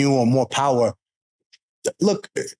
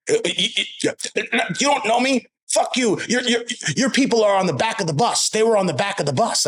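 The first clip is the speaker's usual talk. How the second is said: put-on much higher, sputtering old lady lashing out; flustered old lady; 8 semitones higher than usual.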